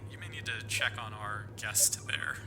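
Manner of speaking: ordinary talk, nothing marked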